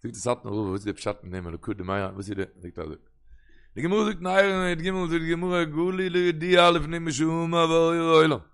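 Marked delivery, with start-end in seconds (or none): 3.7-8.4 slow, sustained sing-song quotation; Talmudic recitation. Real, not acted. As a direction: intone the text with drawn-out words. Chants the quoted Talmud passage. (גמרא ניגון, לאנגזאם און אויסגעצויגן)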